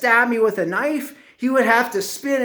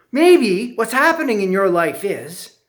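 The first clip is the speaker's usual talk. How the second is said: plain reading voice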